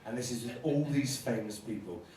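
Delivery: ordinary talk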